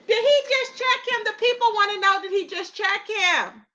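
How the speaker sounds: high, slowing incredulous repeats; mock outrage; scandalised. Real, not acted. Repeated gossip question.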